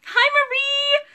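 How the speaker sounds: stretched-out, high, loud hello; high cooing greeting. Acted. Live seller greets a regular viewer with a high drawn-out hello. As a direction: sing out the name sweetly.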